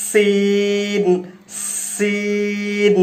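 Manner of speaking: সিন with a long hissing s; letter sin demo. Shown as a demonstration. Teacher shows viewers how to hiss সিন. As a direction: hold the s of সিন through the teeth. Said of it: "সিনটা কীভাবে উচ্চারিত হয়".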